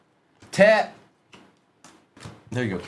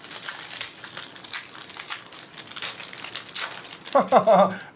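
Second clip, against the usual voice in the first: breathy unvoiced chuckle under the breath; soft laugh; 8 semitones higher, 5 dB quieter than usual. Real, not acted. Host chuckling at a Deadpool card pull, soft laugh. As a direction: let a quiet laugh slip out.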